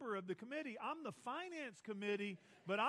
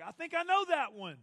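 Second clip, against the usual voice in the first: high, loud sudden recognition; excited recognition; 8 semitones higher, 12 dB louder than usual. Retold or imitated. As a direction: burst out as if you just remembered it. Listener recognizing the famous line.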